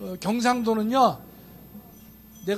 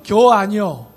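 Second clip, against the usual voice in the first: louder, clipped Chungcheong "겨 아니여"; 충청도 사투리로 툭; Chungcheong dialect; 6 dB louder, slower than usual. Retold or imitated. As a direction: drop it punchily in Chungcheong dialect. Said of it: "충청도 버전은".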